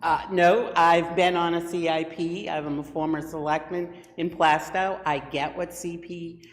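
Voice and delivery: ordinary talk, nothing marked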